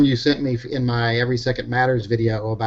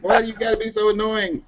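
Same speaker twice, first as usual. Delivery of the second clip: high teasing sing-song voice; squealing baby talk aunt; 9 semitones higher than usual. Retold or imitated. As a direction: tease like a doting aunt. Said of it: "I was like".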